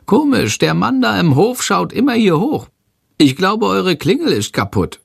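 squeaky-high little creature calling out; high bat voice calling. A narrator's reading. Shandor calls from the ceiling lamp in a high creature voice. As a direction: pitch it way up like a tiny bat. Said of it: "ruft Shandor".

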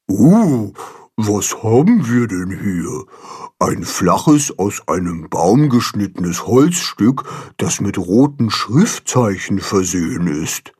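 lightly voiced know-it-all carp; learned carp. A narrator's reading. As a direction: lecture like a wise old carp. Baldrian the wise carp.

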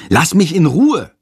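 put-on boy snaps at his teasing classmate; hissing. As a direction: spit it back at him. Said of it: "faucht Jendrik".

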